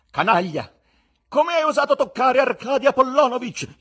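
high, very loud furious threat; menacing. Acted. Wife defends her husband from his attacker, menacing. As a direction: roar the accusation in outrage. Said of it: "chiese con voce minacciosa".

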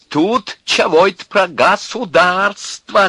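high, loud, drawn-out mockery; vengeful gloating. A narrator's reading. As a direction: throw the question back with malicious glee. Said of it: "с мстительным злорадным чувством говорил Князев".

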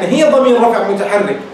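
usual speaking voice

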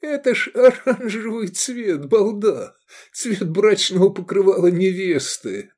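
high, squeezed voice breaking into laughs; helpless laughter. A narrator's reading. Friend explaining the orange tunic, helpless with laughter. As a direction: gasp out the explanation between laughing fits. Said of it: "выдавил Искандер между приступами неудержимого хохота".

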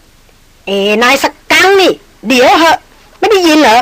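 loud furious yell. Acted. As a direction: shout her hatred at him. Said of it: "หล่อนหันกลับมาตวาดลั่น". (หันกลับมาตวาดลั่นอย่างโกรธ)

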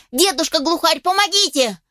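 high, urgent cry for help; puppy calling out. A narrator's reading. Puppy begs old grouse for help, calling out. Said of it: "он громко залаял".

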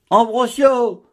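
a sudden high cry of shock and hurt; surprise and pain. A narrator's reading. Mathilde cries out to the prior in hurt surprise. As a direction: cry his name, stunned and wounded. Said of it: "d'une voix qui exprimait à la fois la surprise et la douleur".

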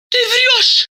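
very high-pitched indignant accusation. A narrator's reading. Masha accuses Diana of lying, indignant. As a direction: throw the accusation out high and outraged. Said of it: "возмутилась Маша".

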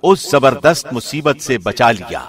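usual speaking voice

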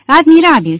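high, loud cry; exclaimed yell. A narrator's reading. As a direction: yell it out exultantly. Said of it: "țipă Izidor".

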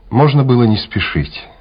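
ordinary talk, nothing marked

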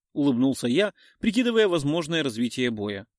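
plain reading voice

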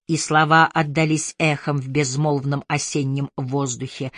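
ordinary delivery

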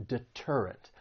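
deterrent with the stress on TER; stress on second syllable. Shown as a demonstration. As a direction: softly say deterrent, leaning on -TER-. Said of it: "The stress is on that second syllable".

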